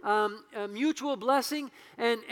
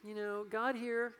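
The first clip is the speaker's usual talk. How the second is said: small, soft, hesitant voice; mock a timid speaker who barely dares speak up; meek, mousy; 8 dB quieter, much slower than usual. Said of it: "standing meekly on the edge going".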